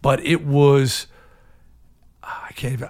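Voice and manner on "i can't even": whisper. Real, not acted. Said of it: "words fail him".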